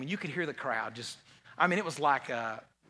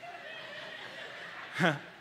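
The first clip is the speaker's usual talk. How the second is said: high, wheezing burst of laughter; a squeaky giggle; 5 dB quieter than usual. Real, not acted. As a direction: laugh up high at your own joke. Laughs at own joke.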